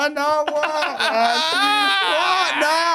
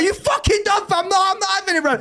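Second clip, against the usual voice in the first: mock road man; 8 semitones higher than usual. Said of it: "that could be a sketch though".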